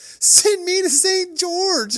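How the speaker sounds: high, loud, slow drawn-out yell; slow, high, loud yell of a slogan; mock yell. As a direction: belt it out like a prank shout. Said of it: "come by and yell it".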